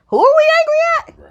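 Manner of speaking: high, loud, fast exasperated question; fed-up fan shouting who are we angry at; exasperated outburst. Real, not acted. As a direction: throw up your hands at the crowd.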